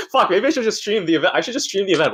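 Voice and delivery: high-pitched, flustered dithering; half-joking nervous spiral upward; played-up panic. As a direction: talk yourself out of it in mock panic. Playing up nerves about the event.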